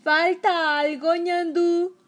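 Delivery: magician's shout. Acted. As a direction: bellow like a stage magician. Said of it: "Era la voz del mago".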